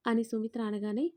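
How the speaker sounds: plain reading voice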